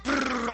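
fluttering lip trill for wings; wingbeat noise. Retold or imitated. Another tom crossing the river, imitated wing flutter. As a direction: trill it like a bird taking off. Imitates a second turkey flying.

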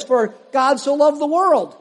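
preacher reading scripture aloud. Acted. Reads John aloud.

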